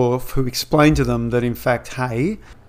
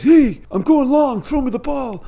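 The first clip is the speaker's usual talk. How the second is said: eager Wesley; 13 semitones higher, 4 dB louder than usual. Retold or imitated. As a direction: play an excited kid calling for a pass. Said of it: "Then Wesley says".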